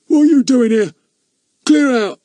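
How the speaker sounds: high, loud shout from a window; angry shout. Acted. Thin-faced man shouting at Fatty to clear off. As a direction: bark him off the property. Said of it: "looked out and shouted at Fatty".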